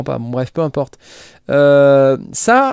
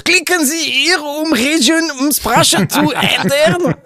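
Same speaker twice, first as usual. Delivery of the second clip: mocking high voice; 13 semitones higher, slower than usual. Reading garbled foreign site text.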